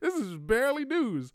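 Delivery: high, loud mock complaint; exasperated protest. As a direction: protest shrilly to dad in disbelief. Acted protest to dad's headline.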